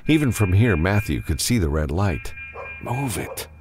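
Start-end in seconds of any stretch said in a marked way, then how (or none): 2.8-3.5 muttered to himself, softer and higher. A narrator's reading. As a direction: mutter it under your breath, urgently. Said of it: "he muttered to himself".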